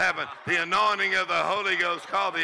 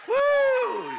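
joyful whoop; 6 dB louder than usual. Real, not acted.

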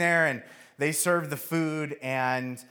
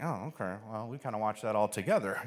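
soft, low, deflated; quiet, embarrassed mumble; sheepish reply; 7 semitones lower, 8 dB quieter than usual. Acted. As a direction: admit it meekly under your breath.

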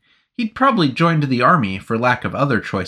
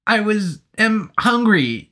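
higher, slower twitchy plea; twitchy nervous soldier; 10 semitones higher, slower than usual. Acted. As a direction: soldier pleading hunger to the officer. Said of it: "he twitched out".

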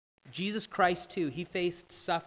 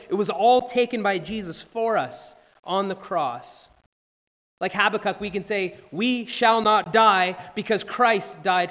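fuller, louder preaching voice, often slowed; rising preaching; 3 semitones higher, 7 dB louder than usual. Acted. Pastor exhorting the congregation at the sermon's close, fervent preaching. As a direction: build to a fervent pulpit climax.

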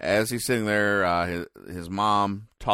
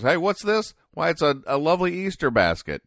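higher-pitched mock surprise; pleasant surprise; 9 semitones higher than usual. Dagwood finding an Easter basket outside, pleasantly surprised. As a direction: read a cartoon husband's delighted discovery.

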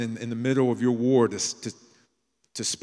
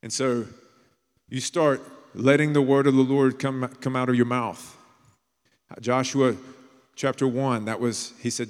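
normal talking voice

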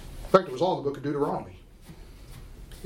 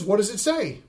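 ordinary delivery

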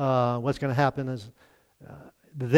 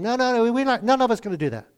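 disciples protesting; 8 semitones higher, faster than usual. Retold or imitated. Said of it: "all the others, of course, vowed the same".

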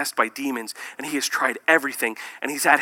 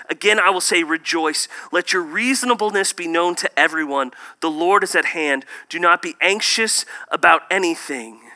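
louder, declaiming Bible reading; scripture reading; 3 semitones higher, 5 dB louder than usual. Acted. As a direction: proclaim the verse to the church. Reads Philippians aloud.

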